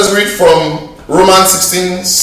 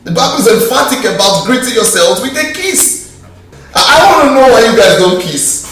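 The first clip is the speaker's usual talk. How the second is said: normal talking voice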